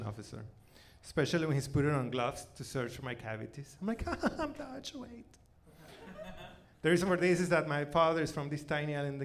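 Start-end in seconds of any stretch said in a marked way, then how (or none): none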